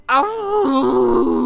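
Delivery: high, loud shrieking creature cry; Wendigo cry. Acted. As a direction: shriek it like a wounded monster. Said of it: "He sounded like a female Wendigo".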